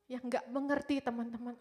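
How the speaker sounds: normal talking voice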